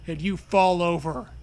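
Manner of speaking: usual speaking voice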